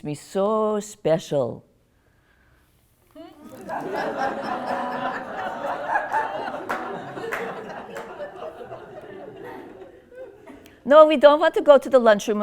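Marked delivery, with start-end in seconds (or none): 3.7-8.8 put-on long wheezy old-lady cackle; cackling laugh. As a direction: laugh at your own joke. Laughs at her own joke.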